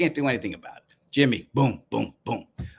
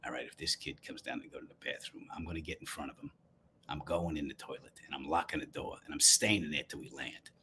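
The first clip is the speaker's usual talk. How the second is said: much softer, plotting under the breath; muttered private scheme, barely voiced; low-volume revenge plan about the bathroom; inner thoughts; 9 semitones lower, 15 dB quieter than usual. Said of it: "I'm thinking to myself".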